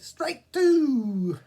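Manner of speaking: high, loud umpire bellow; umpire's call. Acted. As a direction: belt out the second strike. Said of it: "The umpire said".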